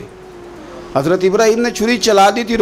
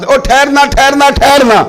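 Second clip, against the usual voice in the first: high, loud call to stop; calling from behind; 8 semitones higher, 4 dB louder than usual. Acted. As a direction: call out urgently to someone ahead. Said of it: "پیچھے سے کوئی دیکھنے والا آواز". (پیچھے سے اونچی پکار)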